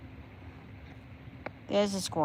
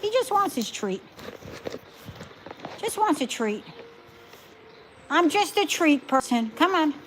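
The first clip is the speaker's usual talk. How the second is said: higher doting voice for the dog; pet talk voice; 6 semitones higher than usual. Real, not acted. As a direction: coo at the dog. High voice to dog.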